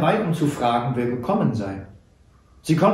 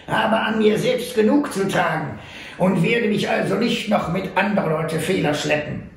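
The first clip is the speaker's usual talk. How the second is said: put-on old trapper; 6 semitones higher than usual. A narrator's reading. Little trapper jokes after apology.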